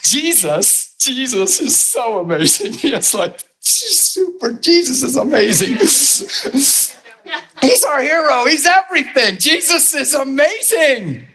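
high, breathy-soft adoring gush; high, soft, dreamy adoration. Real, not acted. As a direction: swoon over Jesus like a fan. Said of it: "you're so in love with him".

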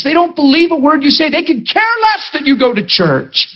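high-pitched, urgent preaching; fired-up preacher in full flow; impassioned preaching. Real, not acted. Preaches at full force about accusing spirits.

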